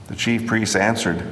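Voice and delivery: ordinary delivery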